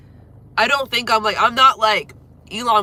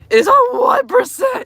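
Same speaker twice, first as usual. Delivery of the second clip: far higher and louder, in mock panic; alarmed shriek; 9 dB louder than usual. Real, not acted. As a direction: squeal it like the phone is dying now. Phone about to die.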